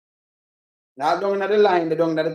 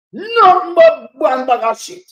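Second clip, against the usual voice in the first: higher, louder and slow, spitting contempt; angry outburst; 6 semitones higher, 6 dB louder, slower than usual. Real, not acted. Caller fumes at the politician's excuses, angrily. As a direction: lean into it like you're fed up.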